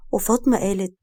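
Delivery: ordinary delivery, nothing marked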